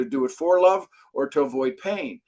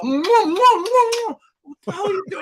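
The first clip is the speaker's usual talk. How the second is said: much higher, slow, baffled; baffled outcry; 5 dB louder, much slower than usual. Retold or imitated. Hearing his mentor back off the phone, baffled and higher. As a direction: stretch out the disbelief. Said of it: "He backs away from the phone".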